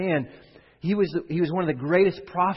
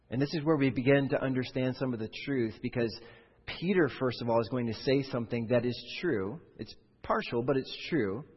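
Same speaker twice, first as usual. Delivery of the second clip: usual speaking voice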